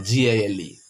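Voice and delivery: G A L E spelled letter by letter, each letter separate; spelling out. Shown as a demonstration. Teacher spelling G A L E for students, letter by letter. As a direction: spell out G A L E for the class. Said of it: "spells gale letter by letter".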